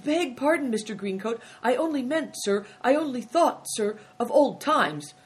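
high-pitched, deferential pleading; placating underling to an angry boss; apologetic innkeeper. A narrator's reading. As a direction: excuse yourself meekly, pitch lifted.

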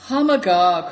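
loud exclamation; cried out. A narrator's reading. Good Fortune cries out to his host, loud. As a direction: call it out sharply. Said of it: "cried Good Fortune".

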